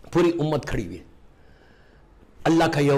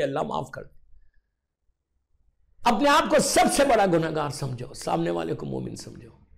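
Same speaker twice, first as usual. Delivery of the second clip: ordinary delivery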